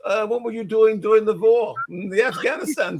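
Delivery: light mock German shrink accent; Freud accent. Friends playing Freud over drinks, mock German accent. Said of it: "that Freud accent".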